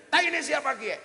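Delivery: high, loud yell; retold yell. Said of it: "Teriak dia". (bengok banter lan dhuwur)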